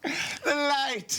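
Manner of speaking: mock agony at the sunlight; mock melodrama. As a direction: ham up being dazzled by light. Mocking actor's over-the-top reaction.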